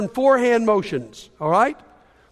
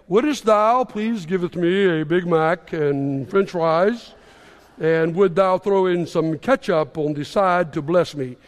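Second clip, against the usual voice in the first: put-on lower, slow, pious old-English voice; mock holy prayer voice; 5 semitones lower, slower than usual. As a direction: ham it up like a solemn churchy prayer at a drive-thru. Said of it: "lower their voice and change their language".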